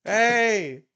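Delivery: loud, high-pitched drawn-out "hey"; mock shouting. Imitating a man yelling in his sleeping baby's ear, mock shouting. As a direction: yell it like waking a sleeper on purpose.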